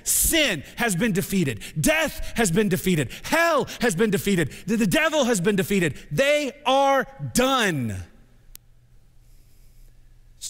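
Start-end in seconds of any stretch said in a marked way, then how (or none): 0.0-8.0 high, loud proclaiming; victory shout. Acted. Pastor proclaiming sin and death defeated, shouting. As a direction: hammer each defeat home, slowing at the end. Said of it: "It's a victory cry".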